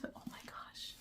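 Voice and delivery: hushed breathless gasp of surprise; surprised whisper. Real, not acted. Finding a personal letter, stunned whisper.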